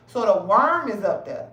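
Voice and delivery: ordinary delivery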